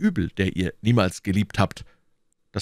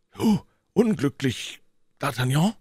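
surprised cry; 6 semitones higher than usual. A narrator's reading. Athos hearing his friend is unhappy, surprised exclamation. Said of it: "rief Athos".

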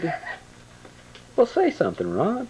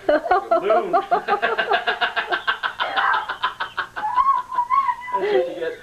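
laughing. Real, not acted. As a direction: squeal it through laughter. Laughing at the baby.

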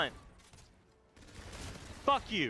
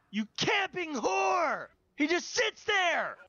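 high, indignant shout; angry accusation; 6 semitones higher than usual. Real, not acted. Player cursing a camping opponent, indignant shouting. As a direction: shout the complaint in outrage.

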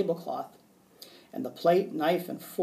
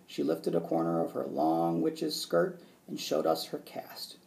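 plain reading voice

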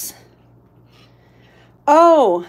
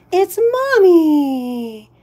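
lightly voiced pet; 3 semitones higher, 5 dB louder, much slower than usual. Voicing the pet greeting owner.

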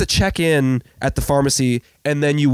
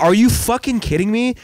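retold outrage; 7 semitones higher than usual. Acted outrage at pharmacy worker.

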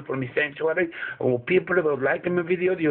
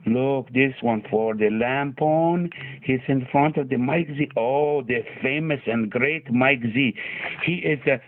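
put-on Afghan accent; 2 semitones lower than usual. Comedy vlog held in put-on accent.

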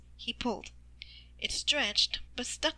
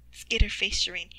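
ordinary delivery, nothing marked

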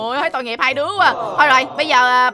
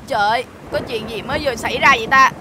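usual speaking voice

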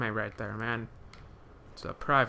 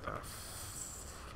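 whispered; 18 dB quieter than usual. Real, not acted. Panicked in zombie game.

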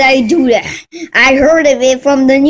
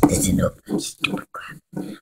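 menacing whisper; 13 dB quieter, faster than usual. Acted. Threatening the noisemakers.

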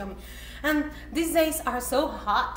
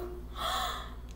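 high soft gasp; surprised gasp; 14 semitones higher, 15 dB quieter than usual. Real, not acted. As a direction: gasp with wonder. Looking at the ice cream.

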